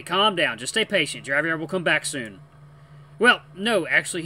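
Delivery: usual speaking voice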